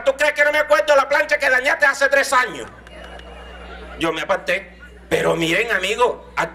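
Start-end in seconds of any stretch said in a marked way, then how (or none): 0.0-2.7 higher, accusing; mock scolding parent. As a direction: throw the old grudge at him. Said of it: "el pretexto era".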